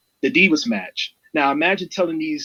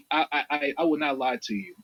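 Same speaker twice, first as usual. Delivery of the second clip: normal talking voice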